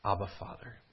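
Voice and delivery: low, soft and calm Abba, Father. Shown as a demonstration. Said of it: "We don't calmly say".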